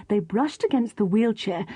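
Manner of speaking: ordinary delivery, nothing marked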